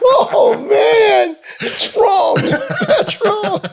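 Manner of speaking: very high, squealed through laughter; real laughter pushing the voice way up; laughing protest. Real, not acted. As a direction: protest while cracking up. Reacting amid everyone laughing at teasing.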